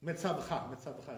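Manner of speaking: Metzavcha as me-tsav-CHA; dropped sheva. Shown as a demonstration. Teacher showing wrong way, Metzavcha with syllable swallowed. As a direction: run v into ch in Metzavcha. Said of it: "is the wrong way".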